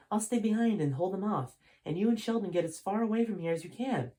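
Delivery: high, earnest assassin; high voice of the loyal assassin. Acted. Voicing the assassin.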